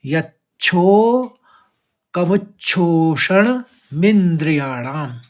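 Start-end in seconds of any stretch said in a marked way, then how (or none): 0.1-1.3 यच्छो drawn out very slowly with stress on च्छो; stretched stressed यच्छो. Shown as a demonstration. Teacher demonstrating the doubled च in यच्छो. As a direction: lengthen यच्छो, leaning on the double च. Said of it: "तीन शब्दों में इस तरह से तोड़ लेंगे".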